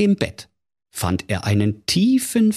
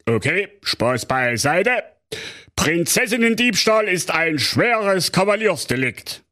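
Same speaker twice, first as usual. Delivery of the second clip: Kommissar Bärbel Ehrlicher; 12 semitones higher than usual. Acted. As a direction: drag it out like a smug small-town cop. Commissioner official.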